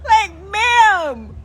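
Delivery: high, loud, slow gushing praise; mock doctor praise. Doctor praising her after the exam, high gushing mock voice. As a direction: gush like a cheery doctor.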